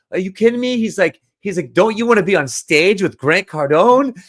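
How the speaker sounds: imitating a pushy salesman who can't believe the no; incredulous salesman. Retold salesperson pushing hard.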